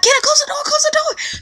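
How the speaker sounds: very high urgent shout; panicked yell. Retold or imitated. To manager Kenna during the riot, high panicked shout. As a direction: screech at her to shut it.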